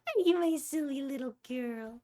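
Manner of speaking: sweet pet-talk voice. Real, not acted. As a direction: coo at the little pet. Cooing at her pet.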